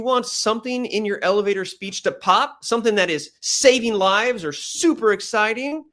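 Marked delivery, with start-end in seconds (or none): 3.4-5.8 high, energised emphasis; lifted, excited pitch; pop with excitement. Shown as a demonstration. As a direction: make it pop. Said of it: "a little bit more volume".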